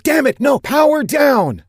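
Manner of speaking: very high, loud frantic yell, slow on power down; shouting. A narrator's reading. Woman yelling at the machine to shut off, high loud shout. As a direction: scream the order before it fires. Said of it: "the woman shouted".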